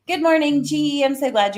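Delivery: high, loud bright hello to a newcomer; excited greeting. Real, not acted. Host greeting a viewer who just joined, excitedly high and loud. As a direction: sing out the welcome.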